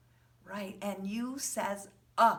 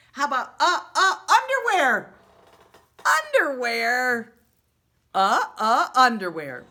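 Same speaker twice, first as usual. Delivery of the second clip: high, loud, teasing "underwear"; teacher hamming up a funny word for giggling children; playful big reveal; 6 semitones higher, 12 dB louder than usual. Shown as a demonstration. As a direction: surprise the kids with a silly word. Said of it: "You're gonna laugh at this".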